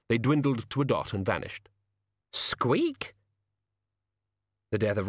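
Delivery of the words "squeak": very high squeak rising at the end; tiny rodent query; questioning rat squeak. A narrator's reading. Death of Rats squeaks questioningly.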